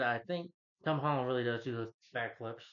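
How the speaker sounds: usual speaking voice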